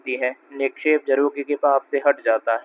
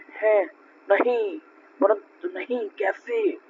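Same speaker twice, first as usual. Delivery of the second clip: rising into a high, breathless कैसे? of astonishment; आश्चर्य से भरी ऊँची पुकार; surprised exclamation; 7 semitones higher, 4 dB quieter, slower than usual. A narrator's reading. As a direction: gasp it out in disbelief. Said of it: "आश्चर्य की ध्वनि निकल पड़ती है".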